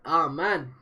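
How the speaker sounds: loud frustrated groan; exasperated cry. Real, not acted. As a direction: let out a loud anguished moan. Reader reacting to a sad page.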